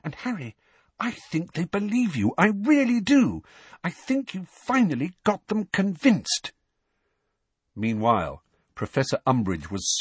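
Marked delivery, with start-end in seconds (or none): 0.0-6.5 thrilled, earnest reassurance pitched high; eyes-shining excitement. A narrator's reading. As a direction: start hushed, grow more excited and insistent. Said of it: "her eyes shining".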